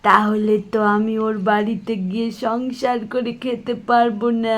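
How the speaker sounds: ordinary talk, nothing marked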